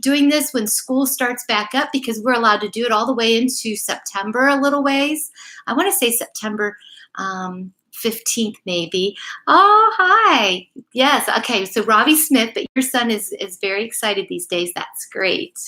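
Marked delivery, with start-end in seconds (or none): none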